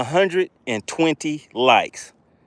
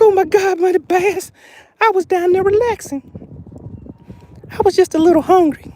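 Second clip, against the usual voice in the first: high fish voice; 14 semitones higher, faster than usual. Acted. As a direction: voice the fish in a high, bright squeak. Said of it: "She's like".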